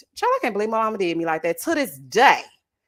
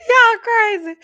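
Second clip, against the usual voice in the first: amused outburst; 12 semitones higher, 9 dB louder, slower than usual. Real, not acted. Amused outburst after laughing.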